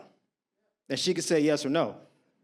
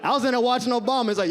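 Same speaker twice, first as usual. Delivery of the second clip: normal talking voice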